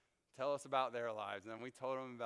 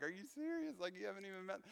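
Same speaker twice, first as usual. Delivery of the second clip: incredulous laugh; 9 semitones higher, faster than usual. Retold or imitated. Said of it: "like, laughing".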